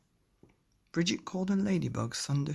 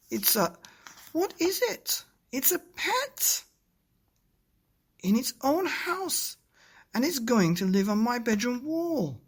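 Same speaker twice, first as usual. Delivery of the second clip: excited dad; 9 semitones higher, 6 dB louder than usual. Acted. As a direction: show off the clock with delight. Daddy excitedly describes cuckoo clock.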